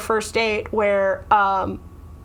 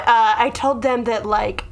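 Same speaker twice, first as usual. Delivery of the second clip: ordinary talk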